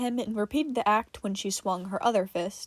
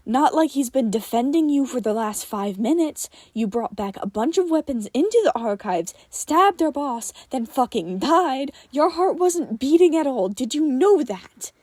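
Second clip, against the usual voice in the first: loud, furious yelling; 3 semitones higher, 8 dB louder than usual. Acted. Tim yelling at Johnny for stabbing their boss. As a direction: let him have it, full volume.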